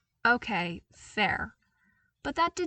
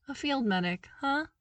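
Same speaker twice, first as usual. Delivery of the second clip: teasing, grinning "field medic, huh"; amused with a grin; 6 semitones higher than usual. Acted. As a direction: smile through it, teasing. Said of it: "he said with a grin".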